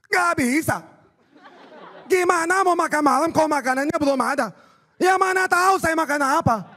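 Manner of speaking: high, loud, heated rant; Ahok impression. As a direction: fire it back like an indignant official. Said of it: "niruin ... Pak Ahok". (tiruan Pak Ahok yang tinggi dan berapi-api)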